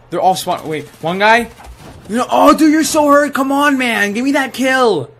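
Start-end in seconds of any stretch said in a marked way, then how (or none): 2.1-5.1 high, loud pleading protest; exasperated yelling. Real, not acted. Gamer pleads for a kill on hurt enemy, exasperated yelling. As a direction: beg at full volume for the kill.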